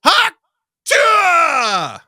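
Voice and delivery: huge drawn-out high shout; belted playground catchphrase; yelled at full lung. Retold or imitated. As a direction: holler it high and loud like a boy out of school. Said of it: "a boy came out and yelled".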